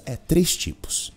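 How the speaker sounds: ordinary talk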